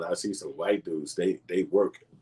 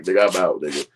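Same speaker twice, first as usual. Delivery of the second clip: loud exit; 2 semitones higher, 10 dB louder than usual. Retold or imitated. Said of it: "And I was like".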